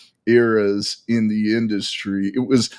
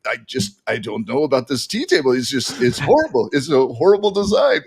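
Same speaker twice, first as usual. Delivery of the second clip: light put-on French accent, complaining; mock French accent, fussing over the tea table. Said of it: "See, I can't, I can't help myself".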